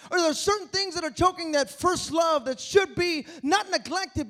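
loud, high preaching that drives every line home; fervent preacher rousing the congregation; full-force preaching. Acted. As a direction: preach it out hard to the whole room.

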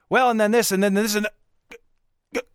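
high old great-grandfather impression, seizing mid-sentence; imitated stuck voice breaking into a cursing outburst; voice freezing up. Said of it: "his voice would freeze up".

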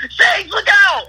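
high, loud yell of alarm; screamed warning. Retold or imitated. Prankster screaming at his sleeping passenger, screamed warning. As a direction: scream it out to startle someone asleep. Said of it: "I scream out".

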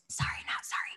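cheeky whisper; whispered aside. Real, not acted. Host whispering a cheeky apology to viewers. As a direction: breathe it out as a sly aside.

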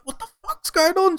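very high, squealed panic; mock frightened falsetto; imitated scared wife. Woken wife asking her husband, high imitated panic. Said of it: "really scared my wife".

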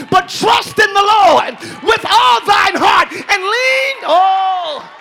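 loud, slow preacher's climax; preacher lifting the scripture with a shout; full-force preaching. Acted. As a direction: stretch it out, loud and high.

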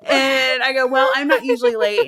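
amused retelling breaking into laughter; laughing. Retold or imitated. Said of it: "So I start laughing".

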